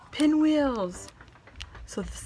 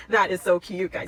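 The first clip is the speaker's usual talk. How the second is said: usual speaking voice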